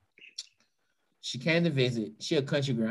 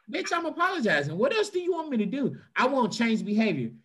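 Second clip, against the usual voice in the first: much higher, fed-up outburst; exasperated high rant; 5 semitones higher than usual. Retold or imitated. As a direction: throw up your hands at the nagging partner. Venting at a nagging partner.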